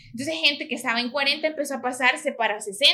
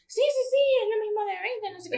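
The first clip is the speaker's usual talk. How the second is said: vendors' eager yes-yes, voiced much higher; mock vendor reassurance; 9 semitones higher than usual. Narrator mimics vendors answering her at the concert, high and eager. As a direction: brush off the question in a high put-on voice.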